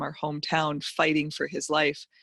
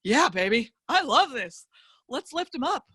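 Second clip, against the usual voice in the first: excited yes; 7 semitones higher than usual. Retold or imitated. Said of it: "I'm like".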